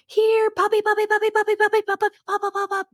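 high, rapid-fire recall squeal; excited puppy call. Retold or imitated. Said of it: "made my voice really exciting".

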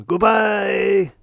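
a bellowed farewell from the puppet chef; loud goodbye. Acted. Finger Chef to the audience, shouting goodbye.

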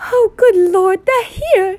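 high, loud, panicked aunt; frantic. A narrator's reading. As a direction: cry out frantically, pitch shooting up. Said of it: "said Aunt Petunia frantically".

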